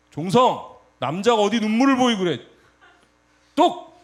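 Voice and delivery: hammed-up telling-off, snapping the last word high; mock scolding. Elder scolding young Jongseong for crying, loud mock telling-off. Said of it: "호통을 치면서".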